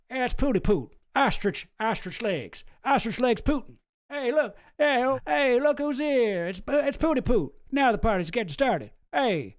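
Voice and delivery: high, gleeful Texan greeting; George Bush impression. Bush voice greeting Putin as Pooty Poot, gleeful. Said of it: "he calls him Pooty Poot".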